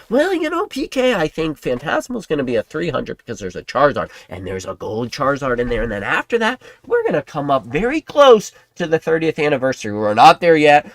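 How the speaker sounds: high, confident mock pundit voice; squeaky know-it-all forecasting prices; mocking Kimmy. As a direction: parody a price predictor. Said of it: "um, Kimmy?".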